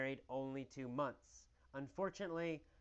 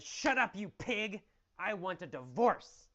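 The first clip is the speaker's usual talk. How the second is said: indignant yell, starting high and loud; righteous indignation; 4 semitones higher, 5 dB louder than usual. Acted. Wife tells her bullying husband she wants a divorce, indignant yell. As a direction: yell it like a wronged wife, acted for a class. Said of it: "When she yells with righteous indignation".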